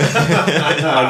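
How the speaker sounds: laughing. Real, not acted. Laughing at George memory.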